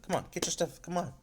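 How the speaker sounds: high-pitched impatient hurrying; irritated. Retold or imitated. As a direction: imitate someone irritably rushing a friend. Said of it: "So she's irritated with her".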